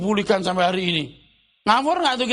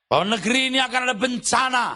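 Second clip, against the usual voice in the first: mock prophet shout; 4 semitones higher, faster than usual. Preacher mocking a false prophet's disaster warning, fast shout.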